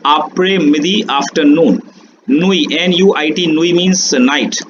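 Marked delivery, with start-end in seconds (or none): 0.1-1.1 après midi said very slowly, every sound clear; slow model pronunciation. Shown as a demonstration. Teacher slowly models après midi for students. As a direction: draw out après midi for the class. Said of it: "grave accent है ई के ऊपर".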